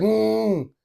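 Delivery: high strained grunt; mock grunt. Kid pushing against his hand, high mock grunt. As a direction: push out a high effortful hum.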